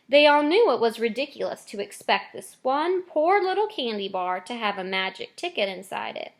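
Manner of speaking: ordinary delivery, nothing marked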